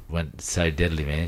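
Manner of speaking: normal talking voice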